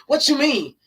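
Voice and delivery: a hammed-up challenging what that mean; mock aggressive man. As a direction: imitate a man pressing her aggressively. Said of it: "he was just very aggressive".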